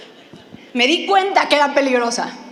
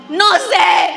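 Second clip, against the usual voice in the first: loud laughing yell of not knowing; shouting back from the car; shouted reply; 3 semitones higher, 7 dB louder than usual. Retold or imitated. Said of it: "todavía le grito".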